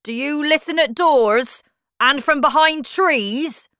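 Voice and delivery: loud, high, indignant question; angry accusation. A narrator's reading. Humpty Dumpty accusing Alice of eavesdropping, angry and loud. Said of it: "He seemed angry".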